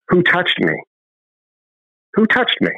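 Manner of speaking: mild urgent calling into the crowd; insistent question. Retold or imitated. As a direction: keep pressing, who touched me. Jesus voiced, repeated question.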